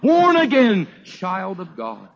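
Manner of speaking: high, loud revival preaching, driving the story forward; fiery preaching. Acted. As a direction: preach it at full force to the congregation. Preacher tells story at full force.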